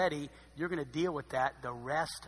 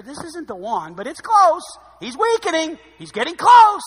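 much higher, louder, urgent build; rising preacher intensity; 13 semitones higher, 19 dB louder than usual. Real, not acted. Preacher to congregation, excited loud build. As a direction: drive it home with mounting urgency.

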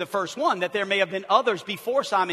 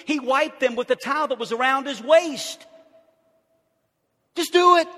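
loud, high, driving preacher retelling; preaching at full force; 8 semitones higher, 8 dB louder than usual. Acted. Preacher thundering the foot-washing story to the congregation. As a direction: build the scene with fire, voice ringing out.

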